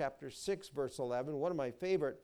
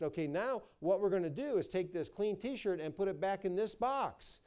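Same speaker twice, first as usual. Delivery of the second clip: ordinary talk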